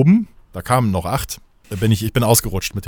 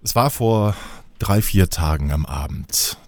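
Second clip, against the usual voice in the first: lower, mock-solemn fairy-tale narration; mock solemn storytelling; 6 semitones lower than usual. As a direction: tell it like a Christmas legend, half joking. (feierlich-ironische Märchenerzählung)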